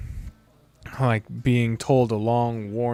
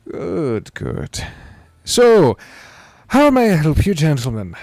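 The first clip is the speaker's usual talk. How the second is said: put-on higher, welcoming old man; old innkeeper; 5 semitones higher, 7 dB louder, slower than usual. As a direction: greet the guests brightly, like a kindly host.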